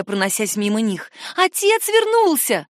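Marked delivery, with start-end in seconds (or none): 1.4-2.7 put-on high, drawn-out girl's shout; excited cry. A narrator's reading. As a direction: call the news out through the house. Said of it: "крикнула Корделия". (высокий радостный крик)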